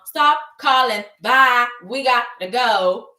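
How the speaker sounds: loud, emphatic brush-off; brusque dismissal. Retold or imitated. Host as Bill hanging up on Hope, brusque and loud. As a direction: cut the caller off loudly.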